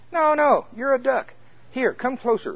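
high, excited duck voice pitched well up; eager duck. Acted. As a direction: coax him like a friendly duck. Lead duck greeting the chicken.

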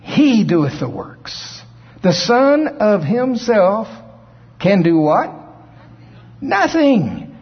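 high, slow, forceful preacher's declamation; preaching. Acted. Preacher proclaiming Jesus's words to the congregation, preaching high and slow. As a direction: proclaim it, drawing out each phrase.

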